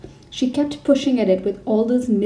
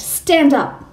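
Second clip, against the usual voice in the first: loud, higher command; barked order; 4 semitones higher, 5 dB louder than usual. A narrator's reading. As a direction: bark the order at the girl. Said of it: "she barked".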